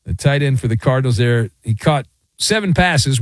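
ordinary delivery

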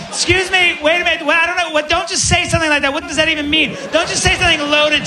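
high-pitched, flustered protest; baffled woman. Acted. Woman at bar questioning Angus, high baffled protest.

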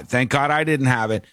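usual speaking voice

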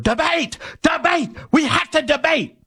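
very high, strained mock shriek; hammed-up shrieking demand; mock screaming. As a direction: imitate a candidate screaming in falsetto. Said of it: "start screaming the word".

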